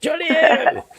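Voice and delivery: very high, loud, hammed-up posh English; posh English accent. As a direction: squeak it like a silly toff. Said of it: "put an English accent on".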